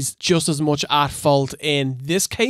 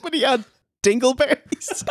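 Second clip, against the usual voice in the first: high-pitched, breaking into laughter; cracking up; 11 semitones higher than usual. Real, not acted. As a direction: barely get the joke out before laughing. Cracks up at own crude joke.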